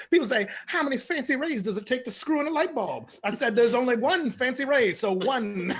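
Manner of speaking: high, loud boastful showman; bragging comic impression. Comic imitating Fancy Ray's bragging joke, high and loud. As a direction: brag it like a big-headed stage act. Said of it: "He's like".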